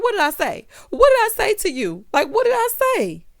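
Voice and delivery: high, loud, escalating questions; mock frantic demand. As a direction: imitate a woman indignantly insisting, rising each time. Retold quarrel, woman demands answer.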